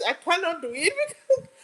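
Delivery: high-pitched, giggly protest; laughing refusal. Real, not acted. Streamer telling viewers she cannot do the accent, laughing. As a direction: refuse while cracking up.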